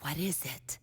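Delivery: parent whispering. Retold or imitated. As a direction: lean in and murmur it confidentially. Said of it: "they whisper in your ear".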